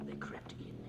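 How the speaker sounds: hushed whisper; spooky ghost tour hush. A narrator's reading. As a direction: breathe it in a whisper. Creeping in, whispered.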